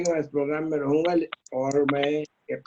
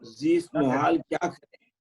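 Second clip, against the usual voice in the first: measured couplets with pauses; ghazal recitation; 3 semitones lower than usual. Acted. Poet sharing an old ghazal with friends, measured recitation. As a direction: recite the verse deliberately, half-line by half-line.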